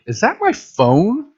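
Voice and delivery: high, slow suspicious question; mock surprise. Jen asking Bianca about her missing phone, high and suspicious. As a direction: drag it out high, half-accusing. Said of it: "and she's like".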